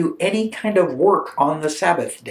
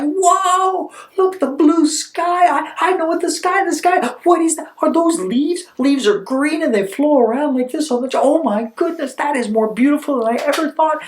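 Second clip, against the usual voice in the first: high-pitched, awestruck exclaiming; amazed wonder; 8 semitones higher than usual. Acted. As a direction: marvel breathlessly at everything you see. Man sees for first time.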